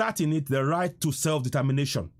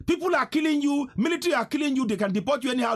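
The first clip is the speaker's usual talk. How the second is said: ordinary delivery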